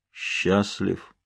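low, soft, toneless answer; monotone creature. A narrator's reading. Figure answering the medium's question, monotone. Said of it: "отвечало своим монотонным голосом".

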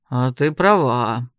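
pensive. A narrator's reading. Wizard admits to the heroine she is right, pensive. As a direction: concede it slowly, still thinking. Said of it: "задумался маг".

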